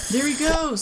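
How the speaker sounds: high excited shout. A narrator's reading. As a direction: point and shout. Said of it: "somebody shouted".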